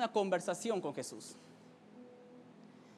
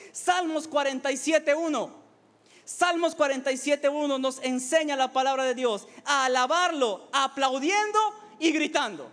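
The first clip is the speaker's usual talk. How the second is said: raised, emphatic sermon delivery; impassioned preaching; 6 semitones higher, 5 dB louder than usual. Acted. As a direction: proclaim it to rouse the congregation. Preaches at full force.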